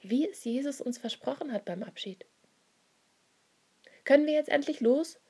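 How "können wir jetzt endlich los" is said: higher, louder, eager call; impatient child calling. A narrator's reading. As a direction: call out like a restless kid. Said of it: "ruft eines der Kinder". (ungeduldiges Kinderrufen)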